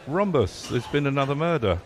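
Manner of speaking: half-hearted Yorkshire detective voice reading the tweet; mock Yorkshire accent, a little overdone; attempted Yorkshire accent. Shown as a demonstration. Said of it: "a Yorkshire accent".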